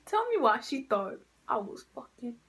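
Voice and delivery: ordinary delivery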